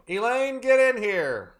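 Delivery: high, carrying call. Real, not acted. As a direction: call someone in from another room. Calling Elaine into the room.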